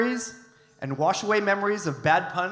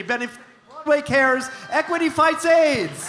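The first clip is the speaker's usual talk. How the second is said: excited callout; 8 semitones higher, 6 dB louder than usual. Real, not acted. Host calls out charity names.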